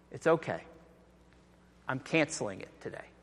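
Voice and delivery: soft, tender release; gentle forgiveness. Acted. Master forgiving the servant's debt, softly. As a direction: softly reassure the kneeling servant.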